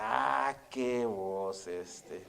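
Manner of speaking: low, soft, very slow mock lament; drawn-out dismay. Landlord despairs of tenant leaving to serve God, drawn-out dismay. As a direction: stretch the "Ah" and "qué" in disbelief. Landlord dismissing preacher's calling.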